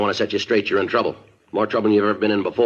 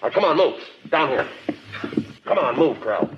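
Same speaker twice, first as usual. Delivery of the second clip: commanding; 9 semitones higher than usual. Acted. Herding suspect.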